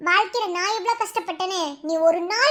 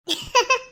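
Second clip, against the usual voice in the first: smug laugh; 4 semitones higher than usual. Acted. Villain laughs after gloating.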